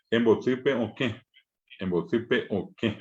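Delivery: "embotipe o qué" slowly, the "qué" nasal; nasal word demo. Shown as a demonstration. Teacher to students showing nasal vowels, slow and careful. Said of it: "yo puedo también decir".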